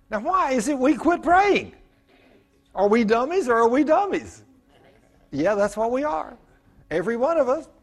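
high, loud teasing rebuke; preaching. Acted. Pastor chiding the congregation, loud and playful. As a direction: chide the whole room with a grin.

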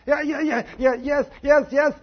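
high, loud frantic yeses; pained kid yelping. Retold or imitated. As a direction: squeal yes like a kid in pain. Pinched boy yelping answers.

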